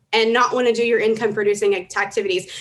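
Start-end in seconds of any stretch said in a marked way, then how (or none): none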